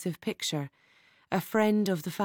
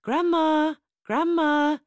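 much higher, louder; excited child's shout; 9 semitones higher, 4 dB louder than usual. Acted. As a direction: call to grandma far off. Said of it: "Marie further off".

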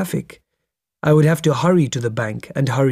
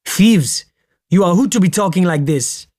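high, loud, frightening indignation; hysterical outburst; 5 semitones higher, 4 dB louder than usual. A narrator's reading. Driver turns on passenger who insulted the ministry, hysterical. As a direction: explode at the accuser, pitch jumping up. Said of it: "then his tone was frighteningly hysterical".

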